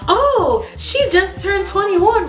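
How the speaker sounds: high-pitched, chirpy announcement; imitating an oblivious, excited friend chiming in; gushing family friend. Mom's friend gushes to stranger.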